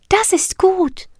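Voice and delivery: very high, slow, relieved fairy. Acted. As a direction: breathe the relief out slowly. Siri relieved.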